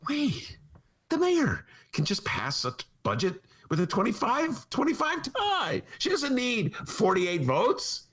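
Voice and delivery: high, dawning mock surprise; mock realization. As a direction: play the sudden realization.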